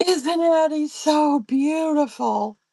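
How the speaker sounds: high, loud, drawn-out gushing. Retold or imitated. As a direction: gush slowly like a doting grandma. Gushing to embarrass her granddaughter.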